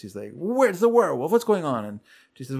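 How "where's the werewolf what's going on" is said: high, louder retold demand; upset. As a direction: imitate an agitated man demanding answers. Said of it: "he's upset at first".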